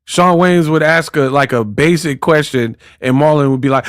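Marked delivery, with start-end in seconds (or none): none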